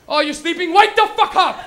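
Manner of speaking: high, loud mock-parent shout; angry parent yell. Parent to son faking sleep, yelling him awake. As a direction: build from sarcastic question to a full yell.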